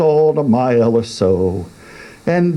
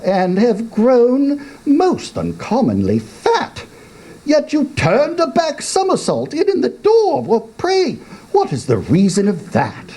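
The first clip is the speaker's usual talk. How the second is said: put-on higher, teasing young voice; cheeky young man; 12 semitones higher than usual. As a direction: needle the old man. Youth voice.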